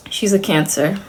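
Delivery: normal talking voice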